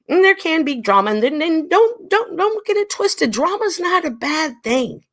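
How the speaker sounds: high-pitched, insistent emphasis. Real, not acted.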